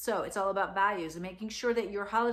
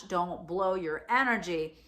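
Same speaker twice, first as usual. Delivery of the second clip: ordinary talk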